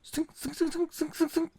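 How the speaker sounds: rapid hissed zings for wires shooting out; high sibilant zipping sound effect; imitated flying wires. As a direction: zip out each wire shot. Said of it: "Wires flew".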